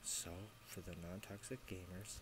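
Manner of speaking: whispered softly right in her ear; feed the line in a hushed whisper. Real, not acted. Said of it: "I'm gonna whisper in your ear".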